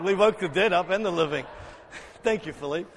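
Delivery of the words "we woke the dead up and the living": ordinary delivery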